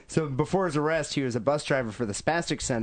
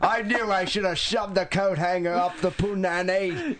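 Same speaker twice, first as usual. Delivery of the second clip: 1 mocking old mother; 6 semitones higher than usual. Voicing mother berating son.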